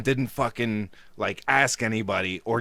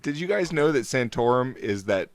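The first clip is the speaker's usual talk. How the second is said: usual speaking voice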